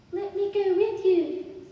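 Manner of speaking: put-on high, loud, drawn-out child plea; begging child. A narrator's reading. Child grabbing adult's arm, begging. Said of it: "The child grabbed my arm and begged".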